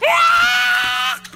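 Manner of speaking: ordinary talk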